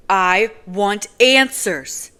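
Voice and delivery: loud, slow, shaking with fury; slow furious demand; furious cry. Acted. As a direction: drag each word out in rage. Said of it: "I cry, shaking with fury".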